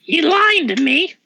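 lightly put-on Aussie accent, accusing; a hint of an Australian bloke calling out a lie; Australian bouncer accusing Wu, lightly voiced; mock Australian bouncer. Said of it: "He goes".

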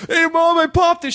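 very high, giddy mock phone call; re-enacted excited announcement. Happiest man calling his mom with news, high excited re-enactment. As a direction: burst out with good news, high-pitched.

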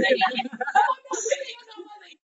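climbing high, tearful-sounding comic freak-out; imitated panicked wail; mock panic. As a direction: act out losing it, voice rising out of reach. Retells panicking at watch alert.

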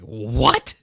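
loud, incredulous burst; shocked disbelief. Retold or imitated. Fan reacting to the show's changed line, shocked disbelief. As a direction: blurt it out, baffled and loud.

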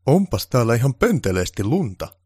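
haughty complaint about the snow; snow-hating grumble. Acted. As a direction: make it plain he hates snow. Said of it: "lausuen sanansa niin, että kaikille tuli selväksi, että hän vihasi lunta".

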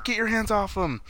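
high-pitched indignant demand; high, hammed-up order; mock protest. Retold protest during the fight.

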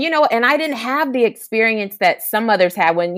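normal talking voice

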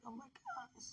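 soft whispered oh my gosh; whispered gasp. Retold or imitated. Seeing a friend at church, whispered surprise. Retold reaction seeing Tamika.

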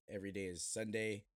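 ordinary talk, nothing marked

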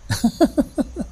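short, high-pitched chuckle; high laugh. Real, not acted. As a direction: laugh lightly at your own joke. Laughs after a joke.

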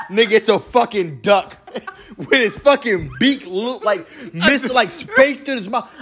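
high-pitched, struggling to talk through laughter; laughing. Real, not acted. Telling friends about a ridiculous game character, laughing hard. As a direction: crack up while describing it.

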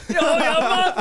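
high, laughing gush; excited mate. Retold or imitated. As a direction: cheer him on through a laugh.

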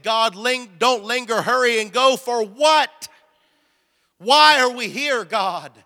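loud, high, exasperated cry to God; retold panic. Man in dark office questions God, retold panic. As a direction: act out a panicked demand, stretching the last words. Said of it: "And I'm in panic".

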